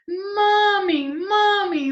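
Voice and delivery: high, loud, joyful calling; thrilled high-pitched owlet shouts; excited owlets. Acted. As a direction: cry out to mother in a high baby voice. Owlets cry out on mother's return.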